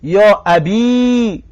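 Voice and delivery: «یا ابی» drawn out very slowly, each syllable held; teacher modelling «یا ابی» slowly for the class; vocative demo. Shown as a demonstration. Teacher modelling the call to students, very slow and drawn out.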